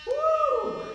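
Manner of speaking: high loud whoop of effort; excited whoop. Real, not acted. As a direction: let out a big cheer. Workout cheer after a plank.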